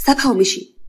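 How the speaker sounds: ordinary delivery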